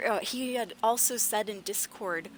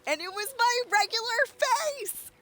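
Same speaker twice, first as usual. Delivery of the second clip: much higher and slower, "my" stretched; indignant; 13 semitones higher than usual. Real, not acted. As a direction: squeal it in disbelief. Outrage at the insult.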